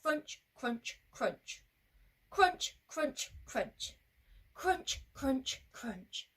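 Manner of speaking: evenly spaced, deliberate CRUNCH each time; march the crunches out without hurry; steady and brave. Shown as a demonstration. Going back bravely, steady measured crunching. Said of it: "Crunch steady, big and brave".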